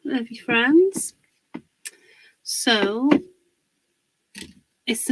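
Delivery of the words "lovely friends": bright lilting hellos, higher and louder; cheery sing-song hello. Real, not acted. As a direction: greet the live chat with a sing-song lilt. Sing-song greeting to live viewers.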